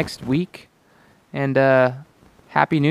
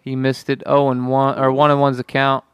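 excited play-by-play call; frantic mock sports commentary. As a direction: call the game like a TV announcer. Live play-by-play of video game.

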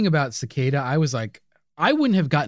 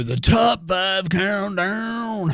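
countdown voice; 7 semitones higher, much slower than usual. Pretend. Announcing the countdown.

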